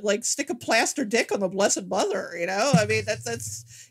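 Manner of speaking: usual speaking voice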